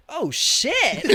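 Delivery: high, loud startled cry of shit; jolted by freezing water, blurt it out; startled yelp. Retold or imitated. Ten-year-old hit by cold shower water, high startled yelp.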